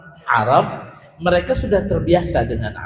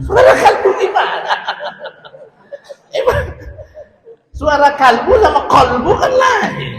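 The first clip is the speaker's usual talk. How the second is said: high, loud laughing disbelief at the mix-up; laughing through it; 10 semitones higher, 5 dB louder than usual. Real, not acted. Teacher laughing at a student's Arabic slip, loud and high. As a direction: laugh out the question, then explain.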